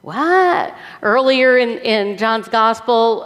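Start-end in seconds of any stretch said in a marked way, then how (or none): 0.0-0.8 high, rising bewildered huh and what; comic confusion; baffled listeners. Acted. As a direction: react like you don't get it at all. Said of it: "They're all like".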